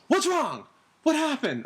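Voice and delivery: high, loud worried calling; a mother shouting from the next room; alarmed mom. Retold or imitated.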